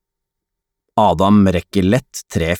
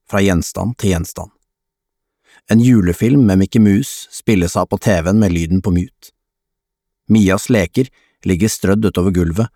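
ordinary delivery

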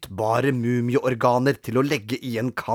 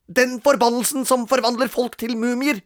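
desperate; 11 semitones higher, 3 dB louder than usual. Acted. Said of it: "sa Robin desperat".

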